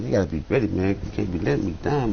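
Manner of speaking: ordinary talk, nothing marked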